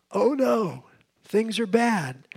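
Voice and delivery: mild mock dismay. Preacher voices the psalmist's first response to evil, mildly dismayed. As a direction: act out a worried first response, drawn out at the end. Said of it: "his first response is to say".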